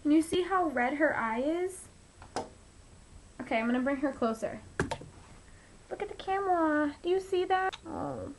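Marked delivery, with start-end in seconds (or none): 5.9-8.3 high, soft pity voice for the cat; cooing to cat. Real, not acted. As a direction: coo over the poor kitty.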